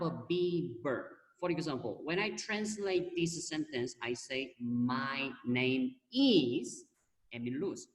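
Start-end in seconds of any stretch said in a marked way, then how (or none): 4.6-7.9 "is" drawn out very long, then a pause; single out "is" by stretching it before the name; slow "My name is", hanging on a long "is"; stress on IS. Shown as a demonstration. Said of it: "this is, be verb, doesn't have".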